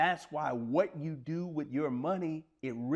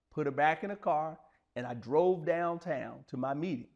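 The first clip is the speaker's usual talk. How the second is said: ordinary talk, nothing marked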